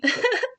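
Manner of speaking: a quick, high little laugh; high giggle. Real, not acted. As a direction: laugh lightly at yourself. Laughs at needing tea.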